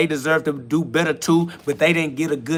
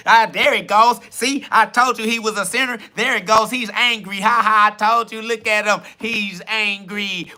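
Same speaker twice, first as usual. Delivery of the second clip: higher, gleeful gloating; gloating at the angry sinner in a higher voice; gloating mock; 6 semitones higher than usual. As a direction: crow over his anger.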